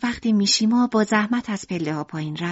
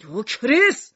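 shouting; 3 semitones higher, 4 dB louder than usual. Acted. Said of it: "میشیما دوباره داد زد".

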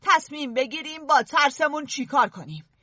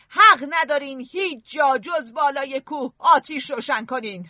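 plain reading voice

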